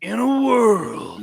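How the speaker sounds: slow trailer announcer; movie trailer voice. Pretend. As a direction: intone it like a trailer. Trailer voice parody.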